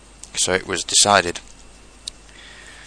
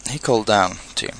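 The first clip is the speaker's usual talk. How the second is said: plain reading voice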